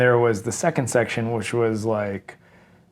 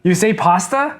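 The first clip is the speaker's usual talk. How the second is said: surprised; 9 semitones higher, 4 dB louder than usual. Real, not acted. Surprised at partner's pronunciation.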